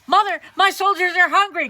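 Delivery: high, loud cry. Acted. Elder son calling over the wall, loud. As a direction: call to mother high and loud. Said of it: "cried the elder son".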